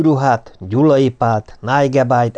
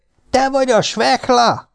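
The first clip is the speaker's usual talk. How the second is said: much higher, mock amazed question; feigned delight; 12 semitones higher than usual. Piki pretending delight on meeting Svehla, much higher. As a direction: act overjoyed to find him. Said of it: "tettetett örömmel".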